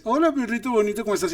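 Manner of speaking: high-pitched cooing greeting; doting dog talk. Acted. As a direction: gush affectionately at a little dog. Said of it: "Si a un perrito tú le dices".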